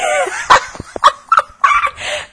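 loud high burst of real laughter; big laugh. Real, not acted. Telling viewers about mom, then a loud high laugh. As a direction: crack up at the memory.

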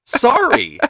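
high, slow sing-song sorry; high, slow, fake-sorry voice; mock apology. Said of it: "It's like".

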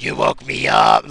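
low, loud, slow grumble; mock wife. Husband imitates his wife's grumble, low and loud. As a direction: ham up a sleepy wife's grumble. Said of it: "She's like".

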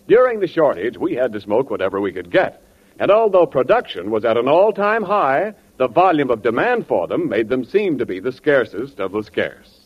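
usual speaking voice

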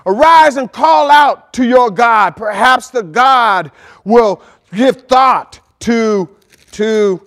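slow, forceful pulpit reading; emphatic scripture reading. Acted. Preacher reading the captain's plea to Jonah, emphatic and slow. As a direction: proclaim the verse, stretching each key word. Reads scripture aloud to congregation.

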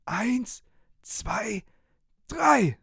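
rising count bursting into a high loud "drei"; countdown building. Acted. Elfi counts down for children to slam the book, rising to a shout.

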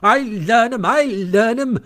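high, loud repeated threat; crying out. A narrator's reading. Toad swings a stick at imaginary foes, crying out. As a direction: cry it out high, belabouring the air. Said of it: "he cried".

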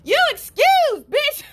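high, loud retold comeback; mock shouted retort; retold yell. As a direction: snap it back high and loud. Retold comeback, much higher louder.

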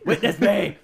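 high, loud laughing war cry; yelled war cry. Retold or imitated. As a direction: scream it like a fanatic. Mad Max quote, yelled take.

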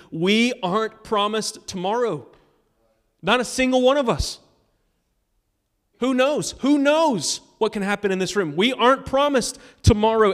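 high, forceful preacher's exhortation; urgent preaching. Real, not acted. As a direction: press the warning on the congregation, driving each phrase home.

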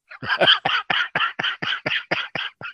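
long, rapid, high chuckle; a run of quick laughing pulses. Real, not acted. Coach joking about having a weird name, laughing.

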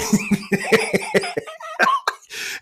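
high-pitched fit of laughter; laughing. Real, not acted. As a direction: lose it laughing at your own tale. Laughs at own story.

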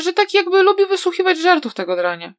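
put-on high girlish voice, half confiding; a young girl trying to sound grown up; a touch of shy girl; lightly voiced young girl. A narrator's reading. Atsing admits reluctantly.

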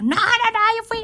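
high-pitched protesting no's; acted-out frantic objection; retold frantic denial. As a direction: protest over and over, voice way up.

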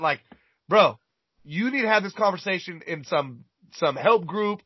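ordinary talk, nothing marked

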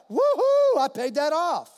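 a loud, high whoop of joy, then a happy "paid off"; gleeful woohoo. Retold or imitated. Man cheering after paying off his debt, high and loud whoop. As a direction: celebrate like the debt just vanished. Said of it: "I'm like".

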